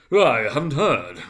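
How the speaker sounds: slow, loud, lazy drawl; yawning fat man. A narrator's reading. Fat merchant yawning to the others, slow and loud. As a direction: yawn it out slowly. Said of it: "yawning again".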